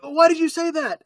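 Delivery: high accusing voice. Retold or imitated. Character quoted accusingly.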